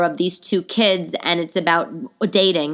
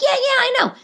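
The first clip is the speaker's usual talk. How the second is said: high-pitched rushed-in reassurance; eager agreement; 3 dB louder than usual. Retold or imitated. Young job seeker bluffing to interviewer, eager and high. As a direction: squeal agreement as if you understand it all.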